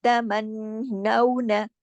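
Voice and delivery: तमन्नौना with the नन hummed long; very slow nasal recitation; full ghunna. Shown as a demonstration. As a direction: hum the double न of तमन्नौना. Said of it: "Akmal".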